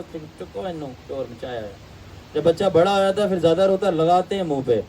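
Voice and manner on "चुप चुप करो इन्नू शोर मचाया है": put-on a mother hushing her crying baby, softly; low, soft shushing of a fussing child; hushed mother scolding. Mother quiets her crying baby, hushed scolding. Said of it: "माँ कहता है".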